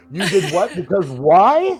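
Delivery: baffled, rising disbelief on WHY; incredulous DM. Retold or imitated. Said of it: "hears it usually is like".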